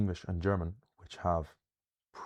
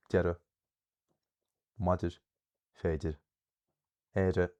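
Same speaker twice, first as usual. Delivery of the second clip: each word from "agus" to "éire" stressed on its first syllable; initial stress; 3 dB louder than usual. Shown as a demonstration. Teacher reading a small list of common Irish words, initial stress. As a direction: read "agus", "eile" and the rest as separate items, punching each first syllable. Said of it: "initial stress".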